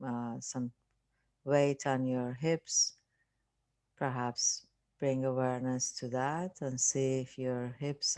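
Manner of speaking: unhurried meditation voice with long pauses; guided meditation. Acted. Teacher leading listeners through relaxing the body, guided meditation. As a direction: calmly guide them through a body scan, leaving space.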